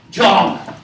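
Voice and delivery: one loud, emphatic shout of the name; loud emphatic call. Shown as a demonstration.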